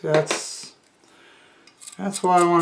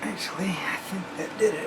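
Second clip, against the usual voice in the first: whispered aside; 5 semitones higher, 5 dB quieter, faster than usual. Real, not acted. Muttering to himself mid-task.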